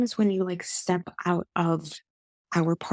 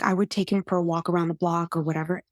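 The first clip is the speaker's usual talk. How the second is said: ordinary talk, nothing marked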